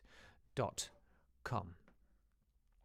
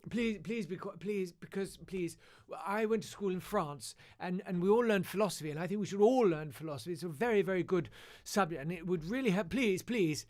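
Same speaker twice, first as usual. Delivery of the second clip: pleading teacher; 7 semitones higher than usual. Retold or imitated. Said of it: "pleading teacher".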